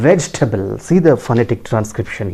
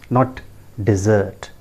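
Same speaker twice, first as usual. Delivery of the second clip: ordinary talk, nothing marked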